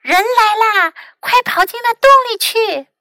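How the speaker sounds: high, loud urgent shout; shouting alarm. A narrator's reading. City mouse urging country mouse into the hole, high loud shout. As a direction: yell the warning and hurry her along. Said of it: "城里老鼠叫喊了起来".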